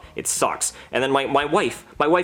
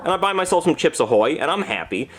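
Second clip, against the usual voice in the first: ordinary talk, nothing marked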